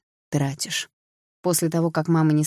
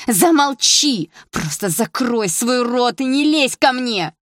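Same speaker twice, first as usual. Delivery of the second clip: much higher, louder, slow furious scream; 7 semitones higher, 6 dB louder, slower than usual. A narrator's reading. Sister suddenly screaming at her brother to shut up. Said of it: "закричала вдруг Саша".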